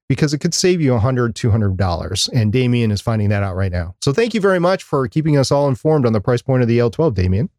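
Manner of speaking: ordinary talk, nothing marked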